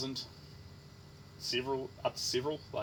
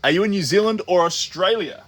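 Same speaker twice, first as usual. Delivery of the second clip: raised voice to the chat; loud question; 5 semitones higher, 12 dB louder than usual. Real, not acted. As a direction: call it out across the room. Calling out to chat.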